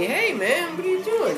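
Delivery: high-pitched imitated greeter; approaching stranger. Voicing the stranger who approaches him.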